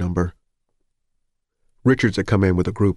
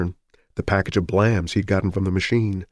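plain reading voice

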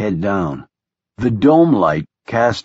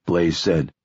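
plain reading voice

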